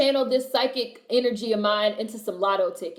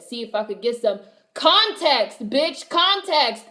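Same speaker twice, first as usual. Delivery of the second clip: louder and slow, stretching context; drawn-out emphasis; 3 semitones higher, 6 dB louder, slower than usual. Real, not acted. Mocking critics who skip the context. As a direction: drag out context, bitch.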